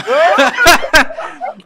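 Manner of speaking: loud teary burst of laughter; tearful laugh. Real, not acted. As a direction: laugh through held-back tears. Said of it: "I'm trying not to cry".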